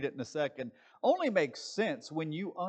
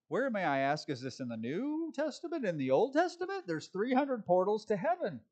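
high, swooping rhetorical question; incredulous; 6 semitones higher than usual. Real, not acted. Preacher challenges the book's claim of heavenly portals, high-pitched incredulity. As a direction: climb way up in disbelief.

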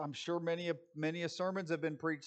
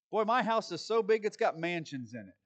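mock boast; 5 semitones higher, 7 dB louder, faster than usual. Mocking the mistranslation.

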